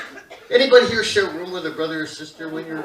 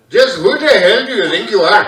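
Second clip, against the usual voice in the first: dad demanding; 2 semitones higher, 6 dB louder than usual. Retold or imitated. Dad's stock line louder.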